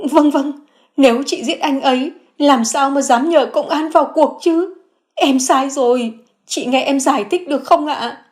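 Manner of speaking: high, pleading woman's voice; Phượng begging her sister-in-law, high and pleading; remorseful sister-in-law. A narrator's reading.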